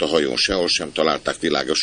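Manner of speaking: ordinary delivery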